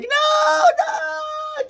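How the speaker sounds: high, crying mock scream; imitated screaming no. Retelling the sister's scream to viewers, a high crying shriek. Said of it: "she was screaming like".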